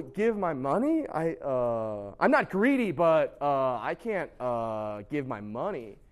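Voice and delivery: halting mock reluctance, sinking low; reluctant hedging. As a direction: hem and haw, dragging out each hesitation. Voices people reluctant to give money.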